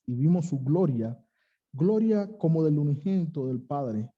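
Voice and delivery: ordinary talk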